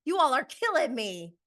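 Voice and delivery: mock outrage at the whole room; imitating angry Sheree. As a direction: throw it back at them, furious. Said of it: "Sheree's like".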